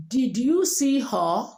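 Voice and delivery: slow Nigerian question, peak on "you"; typical Nigerian accent. Shown as a demonstration. Teacher shows asking as a Nigerian, Nigerian accent. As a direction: Nigerian accent, dip on "her". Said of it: "You want to ask as Nigerian".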